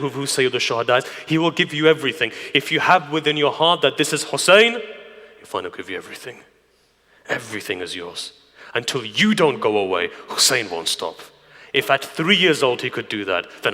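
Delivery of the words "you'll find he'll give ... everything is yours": low, soft assurance; sudden hush. Real, not acted. Preacher assuring listeners of Hussein's giving, hushed. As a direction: drop right down, low and soft.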